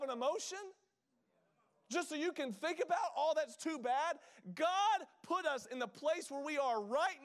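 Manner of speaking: high, impassioned preacher's challenge; full-force preaching. Real, not acted. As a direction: drive each question at the congregation, then land the answer loudly.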